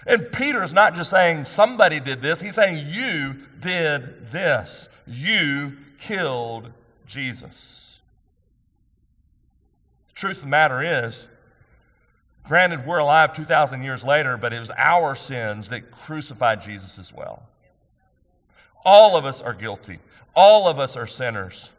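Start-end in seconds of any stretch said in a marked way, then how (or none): 2.9-8.0 slow, hushed accusation; very slow, softer, grave charge; Peter's accusation. Retold or imitated. 18.9-21.7 much louder, driving the point home; loud preaching emphasis on ALL; emphatic repeat. Real, not acted.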